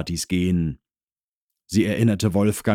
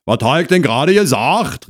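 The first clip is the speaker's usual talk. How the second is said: loud, raised reproach; called out; 8 semitones higher, 7 dB louder than usual. Acted. Husband rebukes wife's wording from inside, shouting. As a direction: holler a reproach from indoors. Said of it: "rief Herr Krause".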